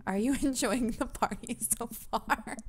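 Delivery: breaking into giggles mid-line; real laughter leaking into the question; laughing through it. Real, not acted. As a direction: try to read it, then crack up. Character line broken by laughing.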